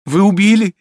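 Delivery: high, loud, aghast question; horrified. A narrator's reading. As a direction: cry it out in horror. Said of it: "с ужасом повторяла молодая девушка".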